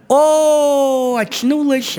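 high, loud mock girl's cry; startled girl. The girl wakes up, high loud mock cry. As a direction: squeal it like a startled maiden. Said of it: "Она говорит".

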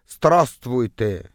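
put-on very slow, drawn-out Estonian accent; strong Estonian accent. Bus neighbour greets a stranger, strong Estonian accent. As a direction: imitate a thick Estonian accent, stretching each syllable. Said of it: "Он сказал с сильным эстонским акцентом".

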